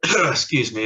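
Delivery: clears throat, then excuse me; a rough cough to clear the throat. Real, not acted. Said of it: "I'm sorry if I keep coughing".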